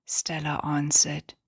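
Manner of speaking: ordinary delivery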